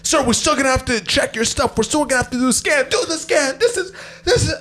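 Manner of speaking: high-pitched, exasperated official; hurried, strained mock orders; imitated insistent officer. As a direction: act out a guard pressing on over protests. Officer insisting over the man's protests.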